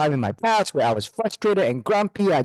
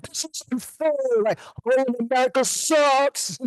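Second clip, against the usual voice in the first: high, mocking whine of upset cruise passengers; whiny passengers; 11 semitones higher than usual. Mocking the upset passengers on the broken cruise, in a high whiny voice. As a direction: sneer it out like a spoiled complainer. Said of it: "and it was like".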